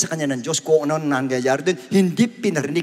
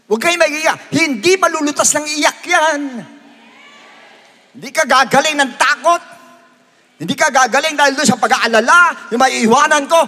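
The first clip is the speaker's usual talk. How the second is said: raised, insistent pulpit exhortation; forceful preaching; 9 semitones higher, 6 dB louder than usual. Real, not acted. Preacher tells worriers not to cry, forceful preaching. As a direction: press the point on the congregation.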